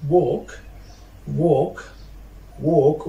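"walk" with no L sound; teacher's careful "walk", L left silent. Shown as a demonstration. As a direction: model "walk" as "wok". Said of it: "I will start with silent letter L".

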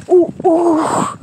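usual speaking voice

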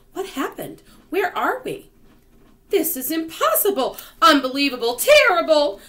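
high, loud, panicky exclamations; alarmed outcry. Acted. Peach travellers discover they are adrift, alarmed outcry. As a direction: cry out in rising alarm and disbelief. Characters shocked to find themselves adrift.

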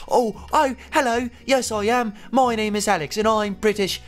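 high-pitched, hammed-up British impression; Alex, British accent. Gamer mimicking friend Alex introducing himself, mock British accent. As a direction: put on a silly high British voice. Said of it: "That's exactly what he sounds like".

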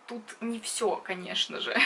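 normal talking voice

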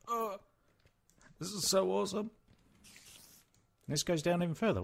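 high excited gush; overjoyed by the find; thrilled. Real, not acted. Exploring a mine shaft, high thrilled voice.

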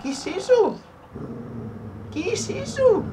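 very high, loud alarmed cry; panicked shriek. Real, not acted. Player spotting something unknown in game, panicked squeal.

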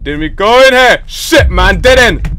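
high, loud outburst; frustrated shouting. Real, not acted. Player hits a dead end in the game, frustrated shouting. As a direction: shout it out, pitch jumping up.

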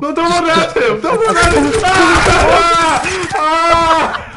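high loud panicked yell. Real, not acted. Teammate runs at an enemy.

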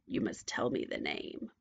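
low, hushed witch demand. A narrator's reading. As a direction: insist in a deep, quiet voice. Witch interrogates princess.

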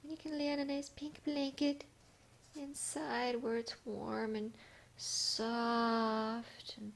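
high, stretched-out pet talk; slow, high doting voice; cosy cooing. Real, not acted. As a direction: draw out the cosy words in a high coo. Cooing about cosy blanket to cat.

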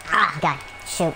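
ordinary delivery

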